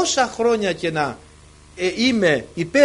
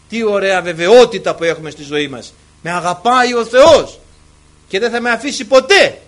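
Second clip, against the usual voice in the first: loud preaching, rising in pitch; fervent proclamation; 11 dB louder than usual. Real, not acted. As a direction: proclaim it with full conviction. Preacher proclaims promise at full force.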